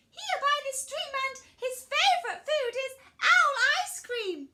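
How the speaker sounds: high, loud little mouse; mouse character. Acted. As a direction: speak up as a high-pitched, confident mouse. Mouse warns the owl.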